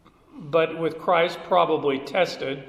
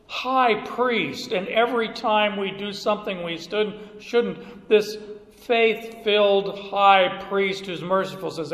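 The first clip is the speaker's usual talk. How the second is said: ordinary talk, nothing marked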